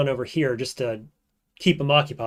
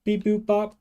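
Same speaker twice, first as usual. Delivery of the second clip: robot beeps; 8 semitones higher than usual. Pretend. Imitating computer noises.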